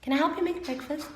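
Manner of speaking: imitating a child offering help; sweet child asking. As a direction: coax mommy like a helpful kid. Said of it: "you come and say".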